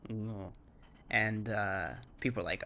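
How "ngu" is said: ngu starting with ng; Thai ngu. Shown as a demonstration. Teaching the Thai word for snake. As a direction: begin ngu on an ng sound. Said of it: "is a snake".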